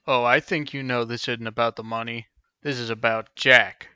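low threatening gangster tone; menacing low voice. Acted. Menacing confrontation.

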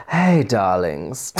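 very slow, drawn-out diva greeting. Acted. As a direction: stretch it out like a languid pop diva. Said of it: "I'll do a [name] impression".

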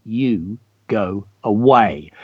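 low, measured, word-by-word dismissal; slow, deliberate command. Acted. Teacher reads Missus Latta after the slap, slow deliberate command. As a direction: space out each word with weight.

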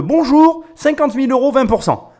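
high, loud, blunt opening pitch; direct English pitcher. Retold or imitated. Commentator mimics an English pitcher getting straight to the money, high and direct. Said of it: "L'Anglais, qu'est-ce qu'il fait direct?".

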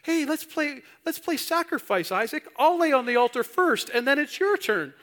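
very high, loud mock-excited dad; hammed-up, over-eager playmate; playful game invitation. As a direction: pitch it up like coaxing a kid into a game. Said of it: "Like, was it a game?".

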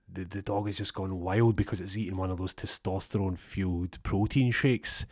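ordinary delivery

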